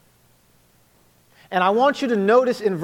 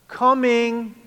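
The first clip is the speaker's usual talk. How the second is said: put-on kids answering again; 6 semitones higher than usual. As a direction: stall with a lazy call back. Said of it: "He would try again".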